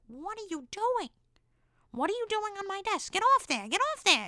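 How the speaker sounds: high, shrill-pitched mock outrage building to loud shouts; scolding teacher. Teacher catching a student lying on her desk, high scolding.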